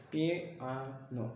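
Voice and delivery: slow, soft "पी आ नो", part by part; split into syllables. Shown as a demonstration. Teacher reading back the outline, "पी आ नो" syllable by syllable. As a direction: sound out "पी आ नो" in three separate pieces. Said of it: "इस तरह के पी आनो कहेंगे".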